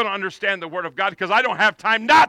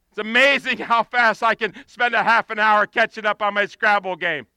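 usual speaking voice

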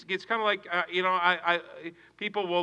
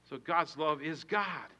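ordinary delivery

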